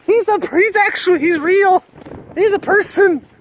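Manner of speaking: very high, loud mock fangirl squeal; starstruck fan. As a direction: squeal in hammed-up disbelief, imitating a fan. Replaying fan's starstruck disbelief.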